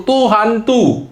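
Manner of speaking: hammed-up fright at a figure walking on water; frightened cry. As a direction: cry out like disciples seeing a ghost. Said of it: "mereka terkejut dan berseru".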